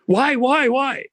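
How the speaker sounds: high, loud frantic why; the terrified servant begging for an answer; panicked servant. Retold or imitated. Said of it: "servant panics again".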